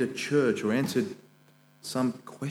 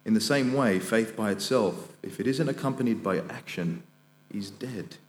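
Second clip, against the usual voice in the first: scripture reading; 3 semitones higher, 4 dB louder than usual. Acted. Preacher reads scripture aloud.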